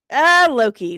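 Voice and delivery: ordinary talk, nothing marked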